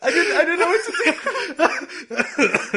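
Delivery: high, tearful laughing speech; laughing through words. Real, not acted.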